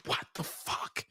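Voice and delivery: hushed, stunned whisper; shocked whisper. Retold or imitated. Whispering in shock when she opens her mouth. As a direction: whisper it in disbelief. Disgusted reaction to bad breath.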